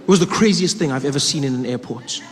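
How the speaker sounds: usual speaking voice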